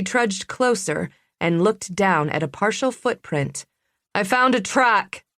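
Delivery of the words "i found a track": excited call. Acted. Bree calls out her find.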